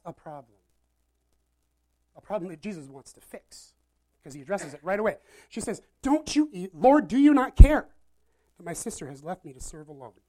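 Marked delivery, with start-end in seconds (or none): 6.0-10.2 sharp, loud reprimand to the Lord; scolding big sister. Acted. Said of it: "she scolds God".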